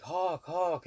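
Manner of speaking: high calling out from upstairs; mock shout from above; yelling down. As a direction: call his name down across the lobby. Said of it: "she's yelling down".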